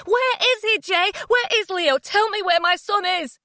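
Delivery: high loud desperate shouts; frantic shouting. Acted. Mother shouting at Jay to reveal Leo's whereabouts. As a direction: shout at him, demand the boy. Mother screaming for her son.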